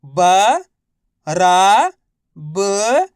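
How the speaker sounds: ब, रा, ब each alone, held and rising; letter-by-letter drill. Shown as a demonstration. Teacher for students to repeat, ब, रा, ब drawn out singly. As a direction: sound out ब, रा, ब with long gaps between. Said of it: "एक अक्षर का उच्चारण".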